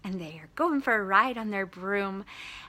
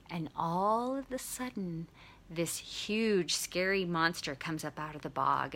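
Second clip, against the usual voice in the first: hushed suspense; 3 semitones lower, 6 dB quieter than usual. A narrator's reading. Storyteller building suspense before monster appears.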